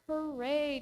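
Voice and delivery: high, hammed-up sarcastic cheer; a fake cheer with a wink; mock hooray. Teacher mocking Ahab's sudden joy, hammed-up hooray. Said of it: "totally not crying for the past couple days".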